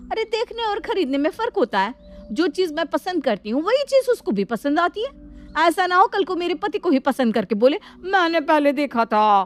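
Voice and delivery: indignant rant. Acted. Elder daughter-in-law mocking her rival to the sister-in-law, scornful.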